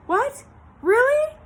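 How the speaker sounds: high, loud disbelief; surprised cry. A narrator's reading. Pig cries out in astonished hope to Charlotte, high and loud. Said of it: "cried Wilbur".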